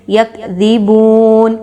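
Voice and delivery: یکذبون, slow and gentle; slow recitation. Shown as a demonstration. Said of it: "ذال کی حرکت کو ہم نے بہت زیادہ".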